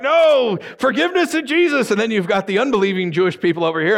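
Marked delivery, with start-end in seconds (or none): 0.0-1.9 mock insistence. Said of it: "they're like".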